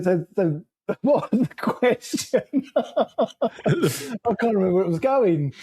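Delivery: slow, laughing at himself; slow giggly ramble. Real, not acted. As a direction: chuckle while losing the thread. Loses the thread, laughing.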